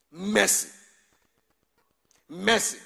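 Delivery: called out loud; loud cry. Real, not acted. Preacher calling mercy loud to the church. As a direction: call out for mercy.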